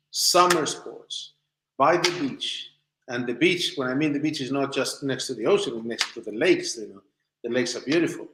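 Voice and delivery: ordinary delivery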